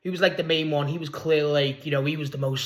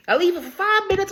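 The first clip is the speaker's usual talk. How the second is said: high-pitched mock old lady; old woman voice. Old woman's baffled mate leaving her, mock high voice. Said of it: "like, another old woman".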